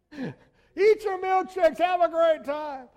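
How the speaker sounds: high, loud and slow carefree call; to the family on vacation, loud, slow, carefree cheer. Retold or imitated. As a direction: let them do anything, breezy and happy. Carefree after not caring.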